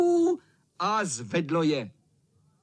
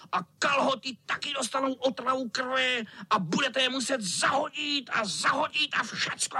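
high, menacing cat squeal; hammed-up wicked cat; scaring tomcat; 9 semitones higher than usual. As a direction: try to scare them, high-voiced. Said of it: "a strašil a kocour".

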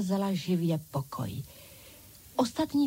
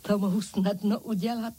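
plain reading voice

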